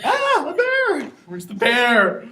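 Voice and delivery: high, drawn-out cry breaking with laughter; hammed-up wail of a crazed seeker; mock frantic cry. Sleep-deprived man hunting the bear, mock frantic.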